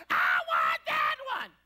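very low, slow, loud bellow of the same plea; deep mock wail. Boy repeats his demand to mom in a low booming wail. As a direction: drop to a booming low groan.

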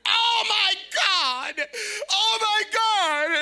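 high, loud, slow mock scream; hammed-up overjoyed wailing. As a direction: shriek it in overjoyed shock. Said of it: "And she's screaming".

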